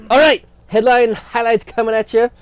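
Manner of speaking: high, loud segment intro; announcer. Acted. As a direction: hype it like a show host.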